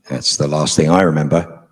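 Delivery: ordinary talk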